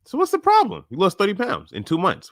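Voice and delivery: high, disbelieving question; can't believe she thinks there's a problem; incredulous. Real, not acted. Coach incredulous at client's worry.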